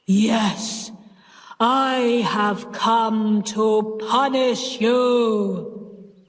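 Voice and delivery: slow, heavy menacing ghost; drawn-out threat from beyond the grave; terrible frightening voice. Acted. Sis as the ghost threatening the aunt, slow frightening voice. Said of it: "in a terrible, frightening voice".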